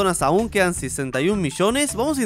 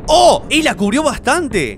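high, loud gasp into slower amazement; surprised exclamation; 8 semitones higher, 6 dB louder, slower than usual. Real, not acted. Gamer seeing the shield take a hit, surprised. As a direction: exclaim loudly, drawing out the surprise. Attack on shielded Earth hits hard.

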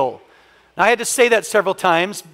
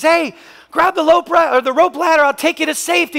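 calling out from helicopter; 9 semitones higher, 4 dB louder than usual. Retold or imitated. Helicopter calls down to man.

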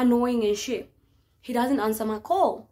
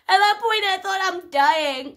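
usual speaking voice

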